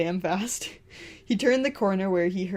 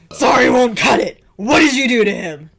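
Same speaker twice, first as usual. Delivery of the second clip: furious shout; 6 semitones higher, 12 dB louder than usual. Acted. Green furious at Red.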